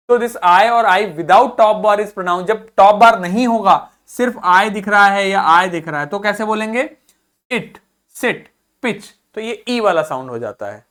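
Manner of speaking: ordinary delivery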